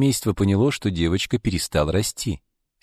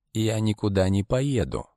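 firmly; 3 semitones higher than usual. A narrator's reading. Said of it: "твёрдо заявила карлица".

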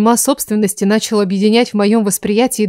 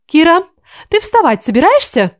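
calling out; 7 semitones higher, 4 dB louder than usual. A narrator's reading. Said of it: "окликнула меня Аниль".

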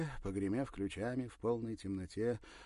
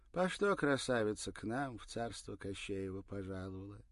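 put-on higher, sly storybook old woman; fairy-tale granny; 4 semitones higher, 5 dB louder than usual. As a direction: tease like a folk-tale witch.

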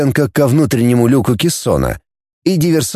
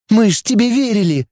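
wounded outcry at a traitor; hurt accusation; 9 semitones higher than usual. Acted. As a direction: throw it at him as a betrayed girl. Betrayed girl lunges at prisoner.

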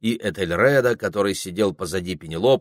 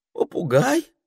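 high rising unsure question; asking back; 12 semitones higher, 4 dB louder than usual. A narrator's reading. Ermine asking back with eyes shut, unsure. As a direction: ask it back in disbelief. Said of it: "переспросил горностай".

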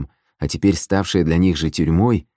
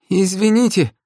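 high, sheepish bleating voice; timid bleat; 3 dB louder than usual. A narrator's reading. Oarsman piping up behind the boss's back, timid bleat. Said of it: "вдруг проблеял за спиной один из ушкуйников".

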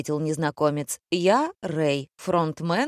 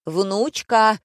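higher, loud one-word hail; barked call; 4 semitones higher, 5 dB louder than usual. A narrator's reading. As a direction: bark out a summons. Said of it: "гаркнул Дмитрий". (громкий окрик)

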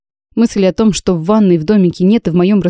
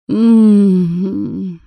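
contented hum. Acted. Heroine sinking into the foamy bath, a long contented hum.

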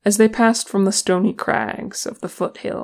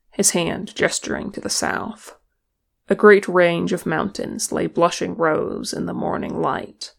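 ordinary delivery

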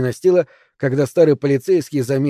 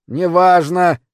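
raised, louder, dismissive yell; 5 semitones higher, 6 dB louder, much slower than usual. A narrator's reading. Old man dismissing girl's worry about leak, yelling. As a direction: cut the argument off with a yell. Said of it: "заорал Финч".

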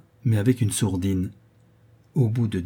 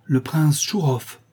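higher, drawn-out name, mildly alarmed; flustered announcement; 6 semitones higher, 3 dB louder, slower than usual. A narrator's reading. Butler announcing an unexpected visitor to the young ladies, flustered. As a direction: announce the guest, slow and slightly flustered. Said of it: "annonça d'une voix effarée".

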